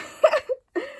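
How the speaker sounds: squealing high laugh. Real, not acted. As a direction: burst into a high-pitched laugh. Laughs at own disgust.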